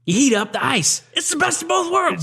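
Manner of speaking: Brickma voice. Retold or imitated. Quoting a favourite Brickma line in a high voice. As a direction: squeak the end way up high. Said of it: "my favorite of all of them".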